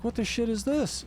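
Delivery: mock baffled outburst at the bill; retold disbelief. Retelling his shock at seeing strange charges, incredulous.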